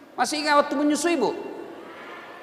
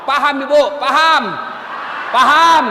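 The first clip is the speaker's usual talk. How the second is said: louder each time; building shout; 8 dB louder than usual. Real, not acted. Preacher pressing the mothers, louder and louder. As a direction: drive the question home. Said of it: "building repeated question".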